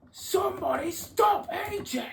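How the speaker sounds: shouting at ringside to stop the attacker; alarmed shout. Acted. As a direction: yell for someone to step in. Commentator reacts to brutal attack.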